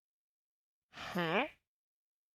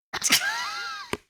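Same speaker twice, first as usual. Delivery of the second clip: loud laugh; 10 dB louder than usual. Real, not acted. Laughing with chat.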